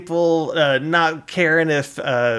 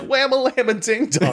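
a hint of a shaky old greaser; 10 semitones higher than usual. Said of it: "he's like".

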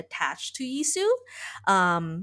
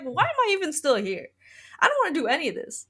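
fast, high, fed-up rattle; high and fast mock complaint; exasperated rant; 9 semitones higher, much faster than usual. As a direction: rush it out like someone overwhelmed. Exasperated rant in a retold story.

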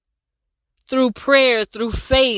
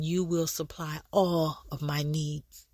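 soft meditative repeat; 8 semitones lower, 11 dB quieter than usual. Shown as a demonstration. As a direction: murmur it like a meditation. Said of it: "we will meditate on".

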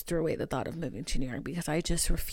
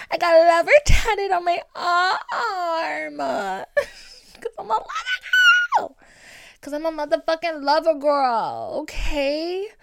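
laughing; 9 semitones higher, 7 dB louder than usual. Real, not acted. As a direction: crack up while saying it. Laughing while telling tattoo story.

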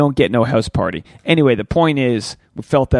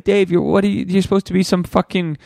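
higher mock listener voice; heckling listener; 6 semitones higher than usual. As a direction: scoff like a doubting fan. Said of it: "you might be like".

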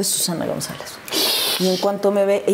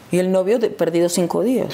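ordinary talk, nothing marked

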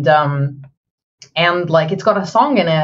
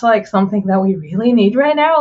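usual speaking voice

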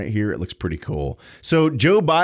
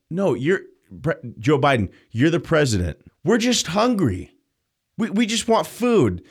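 mock hungry citizen; 6 semitones higher than usual.

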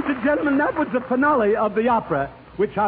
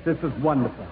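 mood change; 9 semitones lower, 3 dB quieter than usual. Acted. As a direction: melt into a tender mood. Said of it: "Here my mood changes".

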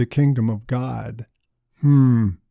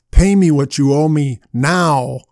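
demand; 5 dB louder than usual. Acted. Servant grabs man by throat.

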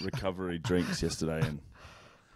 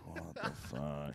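stunned mutter; 6 semitones lower, 15 dB quieter, slower than usual. Retold or imitated. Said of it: "And I'm like".

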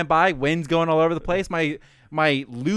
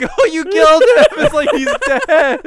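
high, louder shocked accusation; mock horror; 8 semitones higher, 7 dB louder than usual. Storyteller retelling coworker killing the bee, high and loud. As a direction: cry out over the dead bee. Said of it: "me and the other girl".

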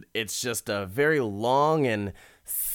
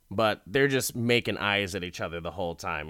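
ordinary talk, nothing marked

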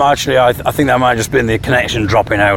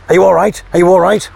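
higher, louder anxious call into the line; worried calling out; 7 semitones higher, 4 dB louder than usual. Acted. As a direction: call out to a silent colleague, worried. Acted call to frozen castmate.

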